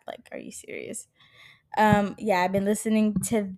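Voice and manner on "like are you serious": whispered aside. Pretend. Mocking herself for sad songs.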